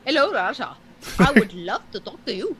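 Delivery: lightly put-on Indian accent. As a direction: greet him in an Indian accent. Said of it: "do your best Indian accents".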